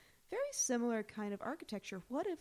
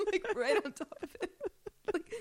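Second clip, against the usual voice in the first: giggling, voice pitched up; laughing; 10 semitones higher, 5 dB louder than usual. Real, not acted. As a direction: laugh out the picture of it. Laughing at an imagined reaction.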